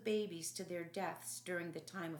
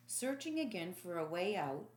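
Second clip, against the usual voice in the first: usual speaking voice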